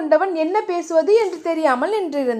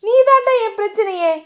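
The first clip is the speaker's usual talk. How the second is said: higher, sharp angry comeback; angry retort; 7 semitones higher, 3 dB louder than usual. A narrator's reading. Diya hurls her anger at Dheeran, angry and higher. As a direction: snap back at him in anger. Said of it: "தியாவும் கோபமாக".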